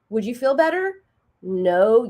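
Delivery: normal talking voice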